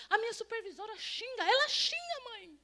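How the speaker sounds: high-pitched imitated whine to mother; acted daughterly protest, climbing high; mock complaining daughter. Acts upset daughter complaining to mother.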